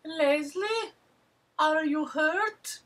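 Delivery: very high slow falsetto; mock concerned son. Son calling to Leslie, high slow falsetto. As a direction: call out high and worried. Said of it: "he sees Leslie and he's like".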